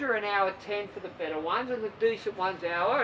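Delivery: usual speaking voice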